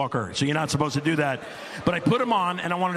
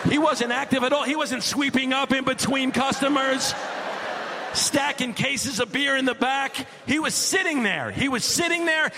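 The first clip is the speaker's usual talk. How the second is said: exasperated rant; 11 semitones higher than usual. Real, not acted. Ranting dad, rising exasperation.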